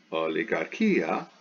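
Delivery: "Oligarchia" joined up, slowly, o-li-gar-KI-a; Greek word demonstration. Shown as a demonstration. Teacher puts the syllables back together, slow whole word.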